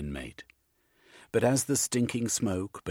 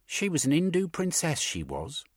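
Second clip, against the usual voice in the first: higher, louder Cockney woman, proud; 11 semitones higher, 5 dB louder than usual. A narrator's reading. She answers the gentleman about her mother, higher and proud Cockney. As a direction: lift her up, a proud East Acton retort.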